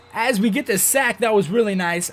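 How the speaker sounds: excited. Real, not acted. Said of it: "I got really excited".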